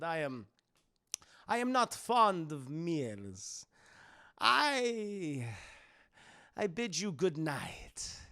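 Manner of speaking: put-on slow, halting vampire accent; Dracula. Dracula takes his leave of Seward, slow vampire voice. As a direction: compose yourself, speaking with stiff courtesy and long pauses. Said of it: "Dracula's like".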